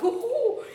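high, loud, laughing "ooh, ooh"; mock-scared "ooh". Students over-impressed by the rule, mock-scared oohing. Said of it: "it almost sounds scarier than it is".

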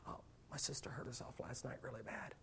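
whispered, near tears; tearful whisper. Retold or imitated. Upset child whispering to the teacher about her sister. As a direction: breathe it out like a hurt child.

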